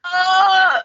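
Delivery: high-pitched mock-wounded "uh!"; offended gasp. Retelling a client's insult, mock offended gasp. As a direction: gasp as if stabbed, hammed-up.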